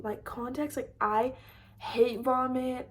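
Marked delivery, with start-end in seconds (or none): 0.0-0.9 whispered aside. Real, not acted. Aside to viewers, very soft.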